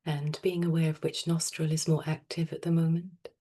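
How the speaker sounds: soft, unhurried meditation voice with long pauses; guided meditation. Real, not acted. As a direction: gently guide them into stillness, phrase by phrase. Leads a breathing meditation.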